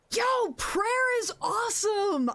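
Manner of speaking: high, slow, drawn-out excited yell; thrilled shout. Real, not acted. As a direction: burst out cheering about the song. Thrilled at song request.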